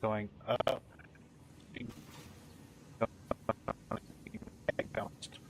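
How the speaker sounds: glitchy lagging mic; choppy robotic connection. Real, not acted. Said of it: "your voice is all robotic".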